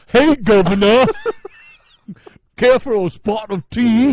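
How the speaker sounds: hammed-up Cockney-style British accent, loud and high; mock British accent. Host shows co-host how British should sound, hammed up. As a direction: play the stage Brit offering tea. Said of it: "How about this?".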